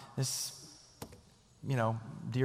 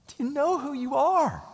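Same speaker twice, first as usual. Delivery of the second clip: "Do you know who you are?" much higher, rising to a peak; soaring emphatic question; 12 semitones higher, 4 dB louder than usual. Acted. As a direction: lift "are" high with conviction. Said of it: "I would love to say to you".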